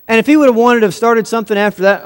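ordinary talk

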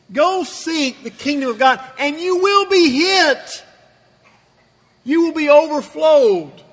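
builds to a loud, high peak; rising preacher shout. Acted. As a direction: swell it up like a revival climax. Preacher voicing Jesus, building up.

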